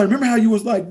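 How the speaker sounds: normal talking voice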